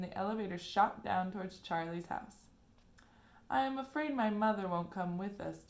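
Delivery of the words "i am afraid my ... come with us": ordinary delivery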